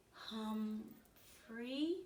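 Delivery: soft, hesitant try at a new word; timid attempt. A narrator's reading. Mother tries her first English word, soft and timid. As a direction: say it shyly, unsure. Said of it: "looked a little frightened, but she tried".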